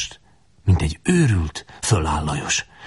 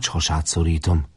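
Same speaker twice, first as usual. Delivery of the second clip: usual speaking voice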